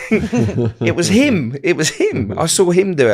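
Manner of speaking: high, laughing, pointing the finger; laughing blame. Retold or imitated. Blaming his mate to mum, high laughing voice. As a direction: giggle while blaming him.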